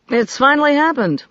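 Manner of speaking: pleased announcement; excited. Acted. Bishop pleased with news.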